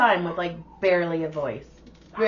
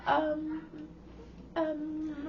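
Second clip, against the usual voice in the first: high, soft, awkward stalling; awkward hesitation; 9 semitones higher, 11 dB quieter than usual. Retold or imitated. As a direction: stall nervously, lost for words. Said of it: "And she's all".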